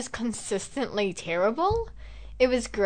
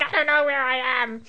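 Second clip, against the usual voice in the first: mock panic; 3 semitones higher than usual. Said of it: "I was, like, panicking".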